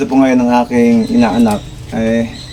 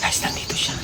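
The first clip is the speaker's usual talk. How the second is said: unvoiced whisper to the viewers; 12 dB quieter than usual. Real, not acted. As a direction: whisper so he won't hear. Sneaking up, not to be heard.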